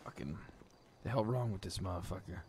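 ordinary talk, nothing marked

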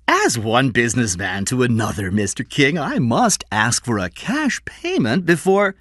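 high, self-important businessman; crooked dealer Score. Acted. Score asks King for cash payment, high slippery voice. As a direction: pitch it up like a slippery salesman.

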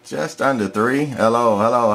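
ordinary talk, nothing marked

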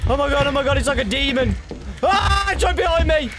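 high frantic screaming panic; terrified yelling while chased; panicking gamer. Real, not acted. Chased by demon in game.

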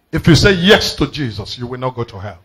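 ordinary delivery

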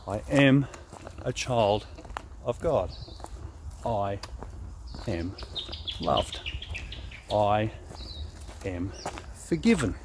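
step-by-step murmured affirmation; paced affirmation. Shown as a demonstration. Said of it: "in time with my feet, in time with my breathing".

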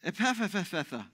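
Ephphatha said very slowly, each syllable stretched; drawn-out Ephphatha. Acted. Jesus speaking the healing word slowly to the deaf man. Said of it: "with a deep sigh said to him".

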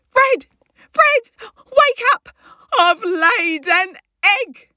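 high, loud, urgent calls to wake someone; excited wake-up call. Acted. Waking Fred to announce egg.

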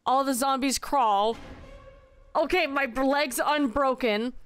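ordinary talk

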